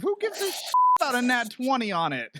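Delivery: very high-pitched indignant outburst; upset, exasperated. Real, not acted. Player griping that initiative wasted a nat twenty, exasperated. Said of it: "You sound so upset about this".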